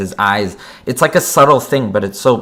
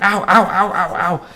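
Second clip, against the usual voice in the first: high, laughing ow ow ow; mock yelps of pain; 8 semitones higher than usual. Superman bitten by his dog, mock yelping. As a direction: yelp like a bitten man, half laughing. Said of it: "and he was like".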